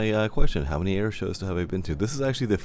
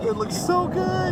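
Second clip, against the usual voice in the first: excited; slower than usual. Real, not acted. Excited over the A-10 flyby.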